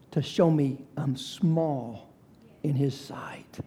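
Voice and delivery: slow, soft and humbled, fading to a whisper; hushed. Real, not acted. Preacher softly admitting he is small before God. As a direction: shrink the voice down small.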